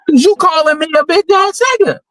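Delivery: high, incredulous comeback; mock confrontation, voice pushed up high; acted indignant retort. Acted reply in hypothetical confrontation.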